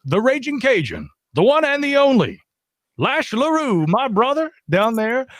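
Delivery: high, drawn-out ring-announcer build; announcer's hype intro. Acted. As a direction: introduce him like a star attraction. Hypes a guest like an announcer.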